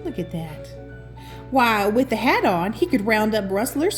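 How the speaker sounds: plain reading voice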